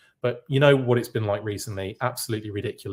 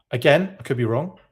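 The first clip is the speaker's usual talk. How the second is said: normal talking voice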